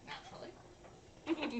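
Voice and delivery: a slow, breathy whisper; smug whispered afterthought; whispered aside. Real, not acted. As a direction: tuck "naturally" away in a sly whisper.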